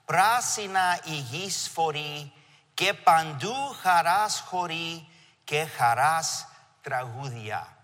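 slow, measured Greek recitation; reciting a spring poem from childhood, slowly; poem recited in Greek. Retold or imitated. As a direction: savour each line of the old poem. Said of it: "I'm gonna read it in Greek".

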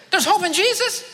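dawning disbelief; a stranger repeating the words he just heard; incredulous stranger. Retold or imitated. Stranger questions, retold dream.

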